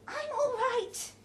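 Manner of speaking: high, slow and croaked; croaking. Acted. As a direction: croak it out after the shock. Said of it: "she croaked".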